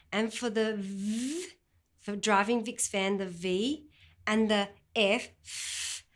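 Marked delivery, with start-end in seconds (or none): 5.4-6.0 "f" breathed out, top teeth on bottom lip; a soft unvoiced "f" hiss; f sound demonstrated. Shown as a demonstration. Teacher modelling the "f" sound for children. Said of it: "the top teeth must be over the bottom lip".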